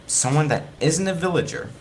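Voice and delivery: plain reading voice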